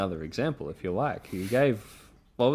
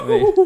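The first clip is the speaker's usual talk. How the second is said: mock Goofy yelp; 12 dB louder than usual. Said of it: "I need one of his yelps".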